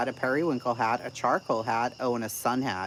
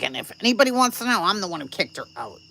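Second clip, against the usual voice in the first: quick, higher mocking quote; mock boast; 7 semitones higher, 5 dB louder, faster than usual. Streamer imitates the woman who kicked her out, mock boastful. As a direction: rattle it off loudly, then settle.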